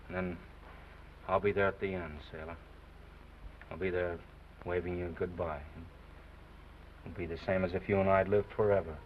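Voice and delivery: tender farewell. Acted. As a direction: say goodbye tenderly.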